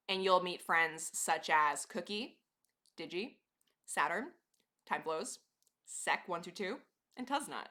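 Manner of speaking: names said one by one with pauses; slow name list, a beat between each. Shown as a demonstration. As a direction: leave a gap before each name. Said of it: "say these names with a pause between".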